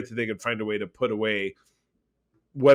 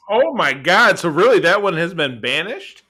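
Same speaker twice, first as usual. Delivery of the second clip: much higher and louder, thrilled recognition; delighted surprise; 5 semitones higher, 7 dB louder than usual. Real, not acted. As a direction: burst out with happy recognition. Hearing the show is banished.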